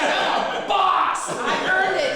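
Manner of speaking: loud, high, stretched-out crowing; hammed-up triumphant client; proud boast, imitated. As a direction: declare it like a champion.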